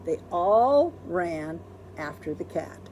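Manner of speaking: usual speaking voice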